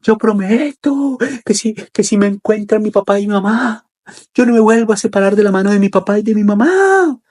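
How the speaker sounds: put-on high, wailing little boy; acted crying child. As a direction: wail a tearful promise to behave.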